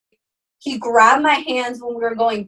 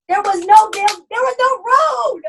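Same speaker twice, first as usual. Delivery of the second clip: panicked yell; 10 semitones higher, 4 dB louder than usual. Retold or imitated. Retold panic in a cornfield.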